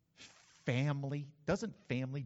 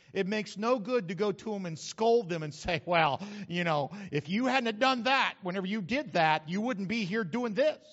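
scolding; 3 semitones higher, 7 dB louder than usual. Retold or imitated. Scolding the lost.

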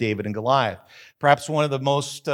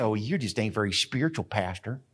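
mock critic; 6 semitones lower, 6 dB quieter than usual. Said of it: "And you say".

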